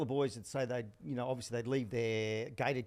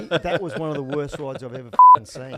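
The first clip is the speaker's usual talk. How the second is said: laughing loud taunt; 17 dB louder than usual. Retold or imitated. Jockey taunting rival mid-race.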